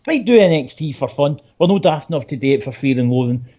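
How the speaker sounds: ordinary delivery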